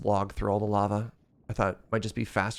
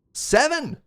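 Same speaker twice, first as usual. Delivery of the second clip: excited call; 13 semitones higher, 5 dB louder than usual. Real, not acted. Announcing the winning number.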